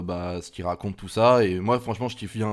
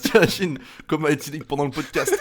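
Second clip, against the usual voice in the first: laughing; 8 semitones higher, 3 dB louder than usual. Real, not acted. Joking about a drunk listener.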